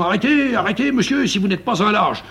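high, loud angry pursuit shout; marquis shouting. Retold or imitated. Marquis chasing the intruder down the stairs, shouting. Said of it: "Il se met à ma poursuite en criant".

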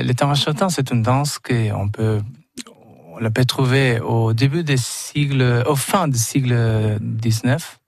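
Argentine accent in French; French with a Spanish accent; foreign accent in French. Real, not acted. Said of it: "mon français, c'est pas top".

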